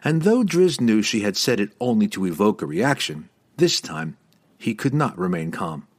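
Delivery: ordinary delivery, nothing marked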